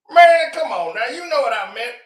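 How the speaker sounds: hammed-up father begging his famous son; pleading dad. Father begging [name] on the phone, high mock shout.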